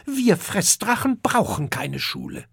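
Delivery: put-on high, snappy young dragon; young eating dragon snapping at the intruder; hissing back. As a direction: spit it out defiantly. Dragon scorns school.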